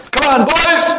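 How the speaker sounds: put-on high, eager call to the boys; rally the gang to go fight; rallying cry. Madhumangal rallying the cowherd boys, high eager call.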